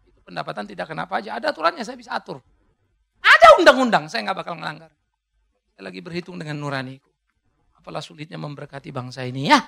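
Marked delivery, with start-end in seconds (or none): 3.2-4.1 emphatic call. Acted. Preacher calling out.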